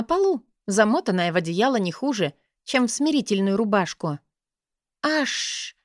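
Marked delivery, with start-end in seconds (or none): 5.0-5.8 hiss of pain. A narrator's reading. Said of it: "зашипела я от боли".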